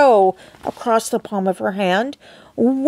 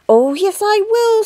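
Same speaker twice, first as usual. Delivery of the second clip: put-on much higher squeaky little boy; tiny squeak; 11 semitones higher, 3 dB louder than usual. A narrator's reading. Shrunken boy insisting to his parents, tiny squeak. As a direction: squeak it defiantly. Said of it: "squeaked the tiny voice".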